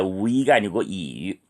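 usual speaking voice

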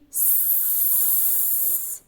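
long, soft "sss"; steady voiceless hissing; snake hiss. Shown as a demonstration. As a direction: imitate a snake in the grass with a sustained hiss. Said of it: "Ось змія повзе в траві".

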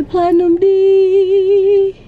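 thrilled reading. Real, not acted. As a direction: savor each word like a prize.

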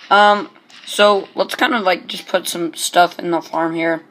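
ordinary talk

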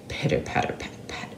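soft whispered pitter-patter; whispered rain. A narrator's reading. Narrator imitating rain in a whisper. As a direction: whisper the raindrops.

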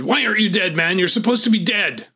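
high, loud, frantic shout; the gunman panicking that his victim won't die; panicked yell. Retold or imitated. He freaked out, jumped up.

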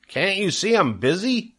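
put-on high-pitched, exasperated boy; disgusted. A narrator's reading. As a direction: brush off the nagging in disgust. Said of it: "replied Avery in disgust".

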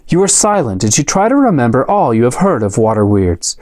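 ordinary delivery, nothing marked

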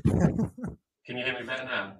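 muffled, like inside a fishbowl; bad mic, sounding far off. Real, not acted. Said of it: "It sounds like you're in a fishbowl".

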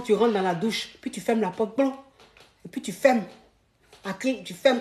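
usual speaking voice

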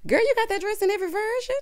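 high, fast incredulous question; incredulous teasing. Real, not acted. Host teasing Precious over repeated dress, incredulous. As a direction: call her out in a high, quick voice.

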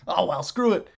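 ordinary talk

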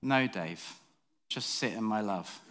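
calm him down gently as God; gentle voice of God. Acted. God gently tells Dave to rest in his love. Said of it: "God was just like".

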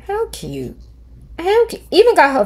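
squealing high with delight; gushing. Real, not acted. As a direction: coo over something adorable. Gushing over a cute cartoon picture.

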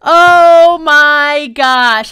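loud, very slow groaned wail; despairing wail. Real, not acted. Gamer lamenting game over, despairing wail. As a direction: drag the words out loudly in dismay.

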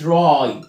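"راي" drawn out as a long "rai"; slow "راي", gliding on the vowel; long I demo. Shown as a demonstration. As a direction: stretch the eye sound in "راي". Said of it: "التلات حروف I".

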